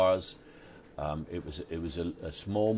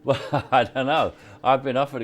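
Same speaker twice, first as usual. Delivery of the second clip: higher, much louder, laughing reply; amused, laughing; 8 semitones higher, 11 dB louder than usual. Real, not acted. Actor answers interviewer about doing all American roles, laughing.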